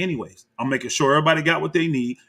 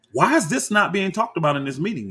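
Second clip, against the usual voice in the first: ordinary talk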